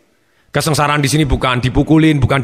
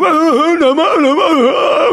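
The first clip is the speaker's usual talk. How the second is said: very high mock tantrum wail; hammed-up child's howl; crying harder; 13 semitones higher, 4 dB louder, much slower than usual. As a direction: bawl like a kid rolling on the floor. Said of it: "Dia mencoba menangis lebih keras".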